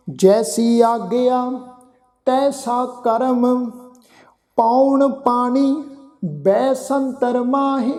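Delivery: slow, measured recitation of sacred verse; slow scripture recitation. Acted. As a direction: lay each phrase down slowly with pauses. Recites gurbani verse slowly.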